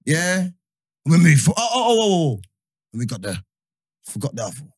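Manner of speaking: ordinary delivery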